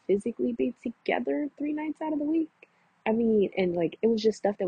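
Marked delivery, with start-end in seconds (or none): 0.1-2.5 high, skeptical question voice; playing her friend, poking holes in the excuse. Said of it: "M J was like".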